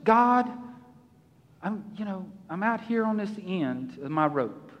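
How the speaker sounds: sinking to a soft, weary mumble; despairing murmur. Pretend. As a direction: sound like you're at the end of your rope. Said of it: "Are we just".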